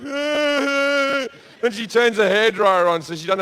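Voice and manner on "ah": high, whiny imitation of a sob; high mock crying wail. To the comedy crowd, a hammed-up high crying wail. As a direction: wail it like a crying girl on the toilet. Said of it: "she's crying, like".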